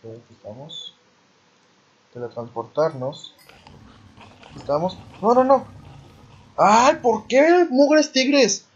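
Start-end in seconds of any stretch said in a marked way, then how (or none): 5.2-8.7 loud, high panicked cry; panicked yelling. Real, not acted. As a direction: yell out in sudden panic. Attacked by tigers in game.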